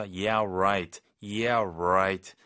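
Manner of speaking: drawn-out, skeptical sarcasm; dripping disbelief on a stretched "right"; sarcastic. Shown as a demonstration. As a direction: say it with a mocking, disbelieving twist. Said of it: "이 말투가 좀 약간 비꼬이는 톤이죠".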